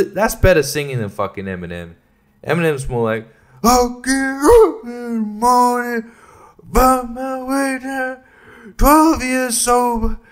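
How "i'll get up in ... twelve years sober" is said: high, slow, sing-song parody of his ballad voice. Retold or imitated. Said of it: "that's kind of the inflection that he has".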